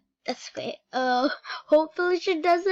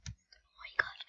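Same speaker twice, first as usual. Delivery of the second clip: hushed under the breath; whisper; 12 dB quieter than usual. Real, not acted. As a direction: whisper so she won't hear. Afraid the friend will hear.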